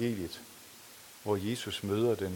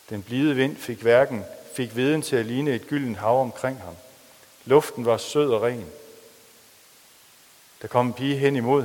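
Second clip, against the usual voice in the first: lively, louder novel reading; story reading; 6 dB louder than usual. A narrator's reading. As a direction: read the story aloud with drama. Reads a novel aloud in sermon. (dramatisk højtlæsning af en roman)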